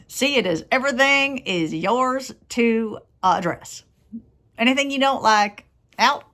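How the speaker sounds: lightly voiced Southern US accent, folksy wise man; Southern accent as Slim. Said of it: "is Slim".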